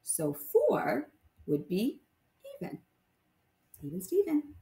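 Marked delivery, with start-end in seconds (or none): none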